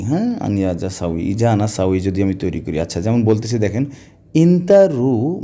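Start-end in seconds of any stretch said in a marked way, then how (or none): 4.3-5.4 ইন্তা রু spoken slowly, higher and louder; drawn out ইন্তা রু for learners; slow and clear. Shown as a demonstration. Teacher drawing out ইন্তা রু for students, slow and clear. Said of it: "মন দিয়ে শুনবেন".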